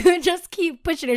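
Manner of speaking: ordinary talk, nothing marked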